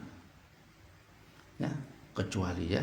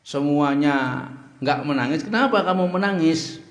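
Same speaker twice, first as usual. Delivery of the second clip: high, loud urgent questioning; Jibril asking loudly; 6 semitones higher, 5 dB louder than usual. Acted. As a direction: call out to the weeping burak. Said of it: "Faqala Jibril".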